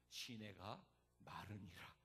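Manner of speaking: low, slow and soft; deadpan scripture quote. Acted. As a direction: let the bad news drop slowly. Deadpan verse dashing hopes.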